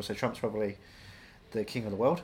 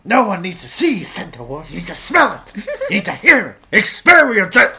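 building, loud Pacino rant; Al Pacino impression; 5 semitones higher, 11 dB louder than usual. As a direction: work up to a Pacino shout.

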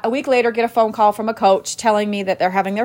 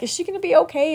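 worried; 7 semitones higher, faster than usual. Retold or imitated. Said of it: "my mommy heart is just, like, pounding".

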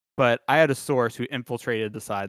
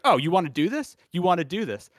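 higher-pitched challenge, playfully indignant; hammed-up outrage, pitched up; mock incensed; 6 semitones higher than usual. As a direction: imitate a riled coach squaring up. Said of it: "Kellen Moore's incensed".

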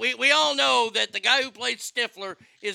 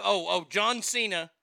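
ordinary talk

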